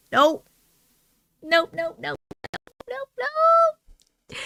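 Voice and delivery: rising panicky nope; backing away in fright; scared refusal. Real, not acted. Reacting in fright to story.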